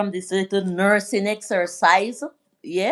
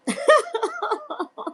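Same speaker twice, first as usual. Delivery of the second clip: squealing laugh up high; high giggle. Real, not acted. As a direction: burst out laughing, tickled. Laughing at her own request.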